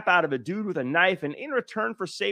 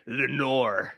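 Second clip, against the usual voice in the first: put-on Irish-accented name, slightly lowered; Liam Neeson; 4 semitones lower, 3 dB quieter than usual. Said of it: "heard that Irish".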